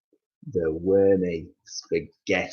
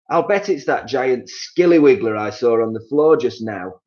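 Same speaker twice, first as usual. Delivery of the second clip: teasing husband voice, a touch louder; sly Mister Twit; 4 semitones higher, 5 dB louder than usual. Acted. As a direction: tease your wife in his own voice. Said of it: "Mister Twit said".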